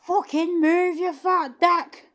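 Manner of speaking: very high, loud Scottish yell; over-the-top shouted Scottish, slowing at the end; Scottish accent. Acted. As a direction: screech it in a put-on Scottish accent. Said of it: "I can do a very good Scottish accent though".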